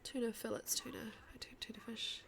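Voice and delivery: softly whispered to herself; breathy quiet practice. Real, not acted.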